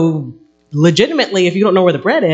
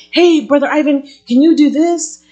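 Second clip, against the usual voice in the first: bright, higher ask to Brother Ivan; friendly request; 10 semitones higher, 4 dB louder than usual. Retold or imitated. Church member asking Brother Ivan, bright and higher. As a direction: call to him cheerfully. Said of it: "ask you".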